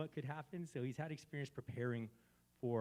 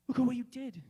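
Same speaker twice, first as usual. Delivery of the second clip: high, loud accusing cry; accusing crowd; 11 semitones higher, 9 dB louder than usual. Retold or imitated. Crowd shouting at Jesus, accusing high and loud. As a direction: throw the blame at him. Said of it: "They said".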